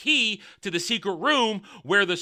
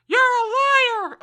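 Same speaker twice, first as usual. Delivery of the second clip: shrill hammed-up shout, much higher and louder; mock yell; 8 dB louder, slower than usual. Audience member heckling the queen, hammed-up shrill shout. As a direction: heckle from the crowd, slowly.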